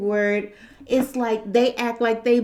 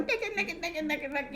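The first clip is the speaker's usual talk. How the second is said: very soft, high-pitched repeated chatter; hushed mock chanting; mimicking; 5 semitones higher, 12 dB quieter, much faster than usual. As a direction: rattle it off high and under your voice. Imitates someone going around repeating it.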